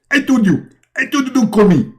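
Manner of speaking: high, loud irritated phone voice; high-pitched mock annoyance; Muhammad. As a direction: scold the caller in a high comic voice. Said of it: "and Muhammad, he answer".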